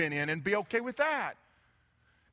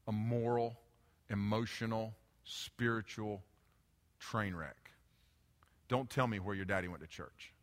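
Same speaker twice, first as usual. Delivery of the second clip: grave confrontation; 9 semitones lower, 9 dB quieter than usual. Acted. Acts Jesus bluntly confronting the woman.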